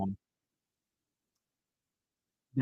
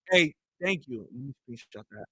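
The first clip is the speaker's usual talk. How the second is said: ordinary delivery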